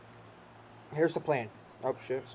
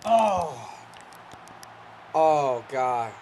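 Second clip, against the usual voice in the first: shocked; much slower than usual. Real, not acted. Shocked reaction to a play.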